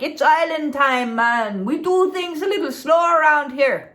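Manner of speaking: lightly put-on, laid-back Jamaican accent; a bad tourist's Jamaican impression; terrible Jamaican accent. Imitating locals in Jamaica, a terrible Jamaican accent. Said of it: "That's a terrible Jamaican accent".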